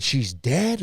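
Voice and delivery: said loud. Retold or imitated. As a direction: say it out loud so the office hears. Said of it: "I said it loud".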